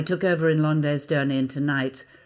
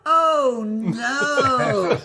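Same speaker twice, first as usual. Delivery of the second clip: audience groan; 8 semitones higher, 6 dB louder than usual. Retold or imitated. Audience groaning at understudy news.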